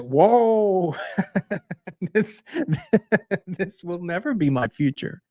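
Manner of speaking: high, laughing disbelief breaking through; laughing while retelling. Retold or imitated. Retold reply, laughing through it.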